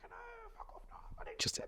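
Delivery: hushed, quick mock refusal; whispered quote. Retelling Vanessa's angry refusal in a fast whisper.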